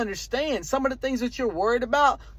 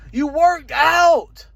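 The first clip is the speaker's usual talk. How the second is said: emphatic hype; 6 semitones higher, 6 dB louder, much slower than usual. Real, not acted. Hyping up the viewer.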